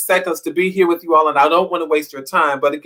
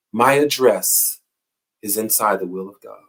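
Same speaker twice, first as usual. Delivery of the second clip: slow emphatic repeat; 6 semitones lower than usual. Shown as a demonstration. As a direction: let each word land slowly. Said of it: "I'll say it one more time".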